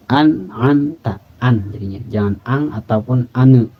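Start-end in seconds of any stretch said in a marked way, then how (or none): 0.1-1.2 "An'amta" slowly, with a clear N and no nasal ng; clear izhar N. Shown as a demonstration. Teacher models a clear N in "An'amta" slowly. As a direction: sound the N in "An'amta" crisply, never "ang". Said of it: "dibunyikan N ya".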